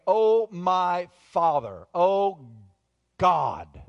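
loud, slow, drawn-out cry to God; anguished prayer. Acted. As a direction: act out a desperate prayer, full voice. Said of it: "He fell on his face praying".

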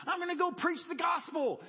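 eager reply to the neighbor; excited. Retold or imitated. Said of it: "And I was all excited".

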